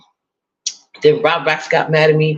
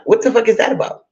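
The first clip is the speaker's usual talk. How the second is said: retold indignant snap; 6 semitones higher than usual. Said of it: "And I'm like".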